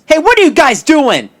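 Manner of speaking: loud, high, disgusted challenge; completely disgusted. Acted. Mark catches Pops and Louie stealing the lamp, loudly disgusted. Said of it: "Mark, completely disgusted".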